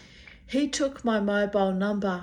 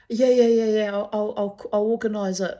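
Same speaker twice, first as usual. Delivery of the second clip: loud, gushing yeahs tumbling out; overeager mock promise; 3 semitones higher, 7 dB louder than usual. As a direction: ham up a drunk guy's eager promise. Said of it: "he'd turn up at the casino".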